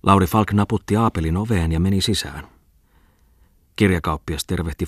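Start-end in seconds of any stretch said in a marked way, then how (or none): none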